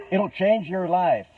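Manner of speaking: loud, slow, high American infomercial voice; big hammed-up American sales promise; mock American. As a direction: stretch it out like a hyped-up American pitchman. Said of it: "I'm gonna do the American thing".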